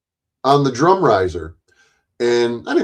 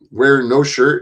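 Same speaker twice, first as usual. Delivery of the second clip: ordinary delivery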